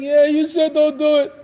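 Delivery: put-on little kid voice; admitting child. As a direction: imitate a child grudgingly admitting it. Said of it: "they may end up saying".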